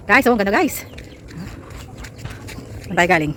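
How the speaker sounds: ordinary delivery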